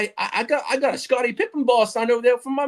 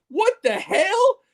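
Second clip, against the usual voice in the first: retold shock; 10 semitones higher, much slower than usual. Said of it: "I'm like".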